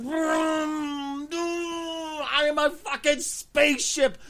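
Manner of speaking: put-on high, loud, slow childish vroom-vroom shouting; hammed-up child at play; mock kid spaceship play. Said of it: "sitting in a box going".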